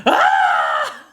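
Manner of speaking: high exasperated "ah!"; exasperated yell. Retold or imitated. Student venting while muted in class, exasperated yell. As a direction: let out a high cry of exasperation. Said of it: "I just kept going".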